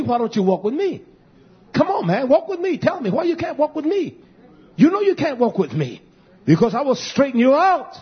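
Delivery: high, loud fiery sermon delivery; full-force preaching. Acted. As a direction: drive it home from the pulpit.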